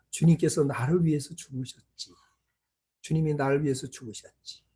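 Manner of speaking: low, soft musing to oneself; hushed realization. Retold or imitated. Preacher recalling the Lord died for him, hushed realization. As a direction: let it sink in quietly, almost privately. Retold inner realization before God.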